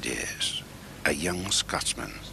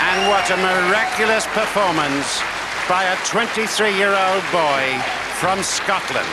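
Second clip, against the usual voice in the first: excited commentary; 8 dB louder than usual. Real, not acted. Champion crowned over applause.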